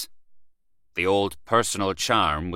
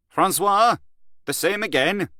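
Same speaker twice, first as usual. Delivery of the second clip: "the same again" called out much higher and louder; calling out to barman; 12 semitones higher, 4 dB louder than usual. Acted. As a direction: hail the barman across the bar.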